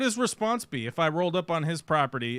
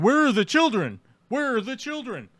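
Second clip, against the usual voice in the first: higher, louder mocking parrot of the reporter; 8 semitones higher, 4 dB louder than usual. Streamer mimicking the reporter's nagging question.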